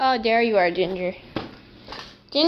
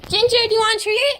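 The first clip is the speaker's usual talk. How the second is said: talking to a dog; 9 semitones higher, faster than usual. Real, not acted. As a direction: squeal it to the dog, laughing. Excited treat offer.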